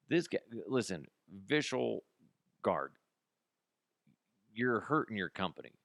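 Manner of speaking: ordinary talk, nothing marked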